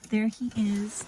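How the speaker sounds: normal talking voice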